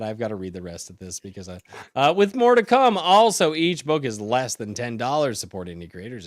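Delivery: normal talking voice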